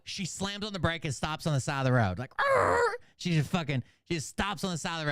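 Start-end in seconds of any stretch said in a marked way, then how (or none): 2.4-2.8 high, loud imitated tyre screech; screeching brakes noise. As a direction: screech like a car slamming to a stop.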